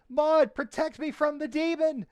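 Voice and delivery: put-on high, loud giggly kid squeal; delighted little boy cry; giggling child. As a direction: shriek it playfully like a child fleeing. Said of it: "And he goes".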